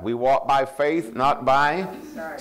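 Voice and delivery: slow, lifted verse reading; scripture reading. Acted. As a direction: read it out slowly, pausing on each phrase. Reads verse slowly.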